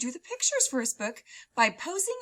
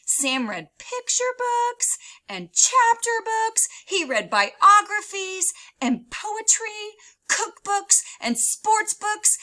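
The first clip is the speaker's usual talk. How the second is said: high, slow, eager list; wide-eyed storybook enthusiasm; excited listing; 10 semitones higher, 7 dB louder, slower than usual. A narrator's reading. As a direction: savor each kind of book with delight.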